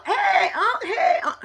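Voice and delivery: high called-out greeting with a cry in it; calling out. Retold or imitated. Uncle Sam calling to his aunt, high call-out.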